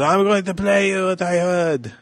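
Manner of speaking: mock Tommy Wiseau. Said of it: "And he goes".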